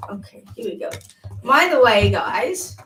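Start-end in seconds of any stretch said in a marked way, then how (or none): 0.1-0.9 hushed mock-spooky whisper. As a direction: lean in and whisper it. Hushed start of spirit reading.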